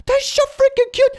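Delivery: high squeal of delight. Real, not acted. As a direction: squeal it out over something cute. Squealing at cute pictures.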